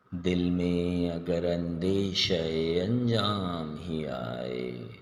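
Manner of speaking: slow, measured poetry recital with long pauses; Urdu ghazal recitation. Acted. Presenter reciting Ada Jafri's ghazal to poetry lovers, slow expressive recitation. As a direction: recite each couplet expressively, drawing out the key words.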